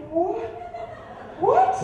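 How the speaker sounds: very high-pitched, incredulous yelp; stunned question shooting way up. Acted. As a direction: squeak it out in acted disbelief. Acted disbelief at clerk's question.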